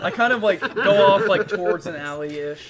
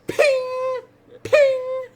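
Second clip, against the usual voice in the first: high loud coin ping; 8 dB louder than usual. Pretend. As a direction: sing a bright high ping. Player mimics coin sound effect.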